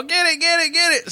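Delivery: very high frantic pleading; acted panic. Storyteller playing Bob with lizard on his head, high frantic plea. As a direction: squeal it like a panicked coworker. Bob bent over, begging for help.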